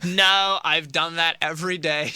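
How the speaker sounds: lightly voiced bored valley girl. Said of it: "to a Valley Girl impression".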